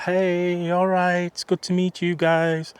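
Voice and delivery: high, loud, drawn-out attempt at a celebrity's voice; badly faked accent, high and slow; Renée Zellweger. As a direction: imitate the actress's friendly greeting, pitched right up. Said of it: "I can't do her accent".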